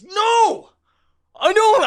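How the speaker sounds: high, loud shouted no; angry bartender shout. Retold or imitated. Bartender shouting at the returning duck, high and loud. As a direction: bark it at the duck.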